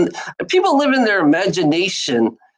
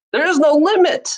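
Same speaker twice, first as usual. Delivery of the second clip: emphatic outburst; 10 semitones higher than usual. Real, not acted. Ranting about limits.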